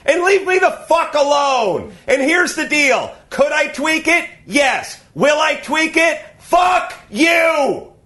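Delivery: yelling, pitch way up; escalating comedy rant. Real, not acted. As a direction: blow up at them, full volume.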